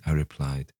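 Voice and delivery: usual speaking voice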